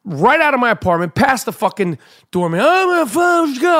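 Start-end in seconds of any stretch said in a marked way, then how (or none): 2.6-3.8 high-pitched panicked babble. Retold or imitated. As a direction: blurt it out in a frantic panic. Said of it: "I'm in a fucking panic".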